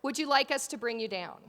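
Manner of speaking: low, deliberate mock announcement; PA operator. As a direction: imitate a deadpan ride operator. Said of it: "the kid comes back on".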